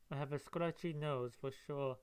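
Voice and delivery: ordinary delivery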